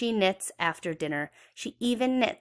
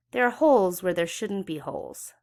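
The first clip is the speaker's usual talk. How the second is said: ordinary delivery, nothing marked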